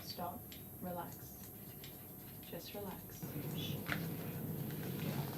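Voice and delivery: very soft murmur to calm a dog; soothing. Real, not acted. Groomer calming her squirming dog, very soft murmur. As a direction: hush the dog gently, almost under the breath.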